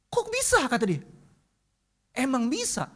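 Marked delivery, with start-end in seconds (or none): 0.1-0.6 high, amazed disbelief; astonished question. Retold or imitated. Boy asks the preacher in amazement, astonished. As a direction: ask it in wide-eyed wonder.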